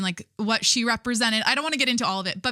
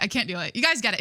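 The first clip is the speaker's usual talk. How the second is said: ordinary talk, nothing marked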